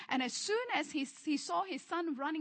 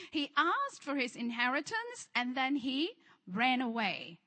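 normal talking voice

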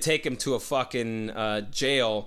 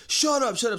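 high, louder snapped order; retold silencing yell; 11 semitones higher, 5 dB louder than usual. Woman on top of her captive, high yelled order. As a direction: cut him off with a yell.

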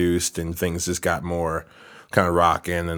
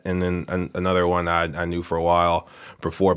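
ordinary talk

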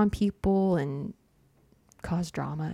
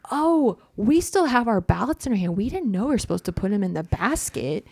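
mock innocent excuse; 3 semitones higher, 4 dB louder than usual. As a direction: feign innocence, mimicking a lame excuse. Mimics voters' convenient excuse.

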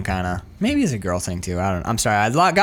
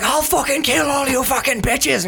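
higher-pitched mock threat; Colin Farrell; 7 semitones higher than usual. Acting the man threatening the women, high mock rage. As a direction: rant it as a hammed-up angry man. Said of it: "Colin Farrell's like".

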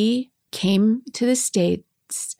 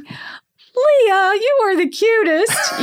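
high and louder, gushing; delighted; 13 semitones higher, 4 dB louder, much slower than usual. Retold or imitated. Telling Leah she is the cutest, high gushing delight. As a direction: gush over her like she's adorable.

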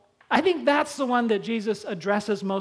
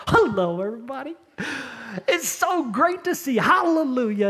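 a hint of sing-song church cheer, higher; fake cheerful greeting; 4 semitones higher than usual. Family steps out of the car, greeting the church, plastic cheer. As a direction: switch on a plastic smile. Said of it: "it's like".

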